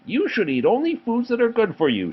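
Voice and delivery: high prim teacher voice, pitched well up; strict teacher voice. A narrator's reading. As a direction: play the prim health teacher lecturing. Teacher voiced in read story.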